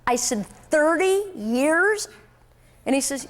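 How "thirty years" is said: incredulous echo. Retold or imitated. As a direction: stretch it out in disbelief. Echoing salesman's answer in shock.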